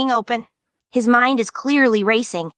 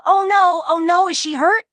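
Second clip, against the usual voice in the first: panicked; 10 semitones higher than usual. Retold or imitated. Narrator reliving panic as Ragatha lies still, alarmed. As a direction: gasp it out in sudden fright.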